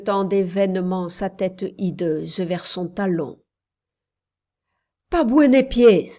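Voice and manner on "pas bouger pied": very loud, raised warning; commander's shout. A narrator's reading. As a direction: shout an urgent order to freeze. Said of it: "cria la voix du commandeur". (ordre crié de commandeur)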